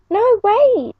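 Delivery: high call after someone leaving. Acted. Jay calls to the departing wolf, high urgent call. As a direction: stop her from going. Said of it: "called Jay".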